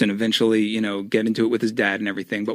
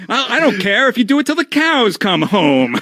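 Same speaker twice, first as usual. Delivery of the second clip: imitating an old-timey ham, much higher and louder; old-timey dad; 10 semitones higher, 5 dB louder than usual. Cena's dad ad-libbing at the wrestlers, old-timey hammed-up voice. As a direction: ham it up like a folksy old-timer. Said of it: "that old-timey".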